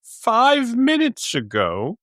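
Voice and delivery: high, slow, baffled voice; bewildered quote. Retold or imitated. Recalling watching the clock jump, mock baffled. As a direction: act out staring at the clock in disbelief. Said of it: "It was like".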